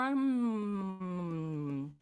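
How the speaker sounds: long closed-mouth hum, sliding down; chewing hum. Shown as a demonstration. Coach warming up with students, humming and chewing. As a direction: chew gently through a sustained hum. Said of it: "just chew a little bit more".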